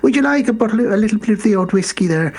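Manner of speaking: high, lilting put-on Irish accent. [name] offering whiskey to Beaks, mock Irish accent. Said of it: "He's putting on an Irish accent".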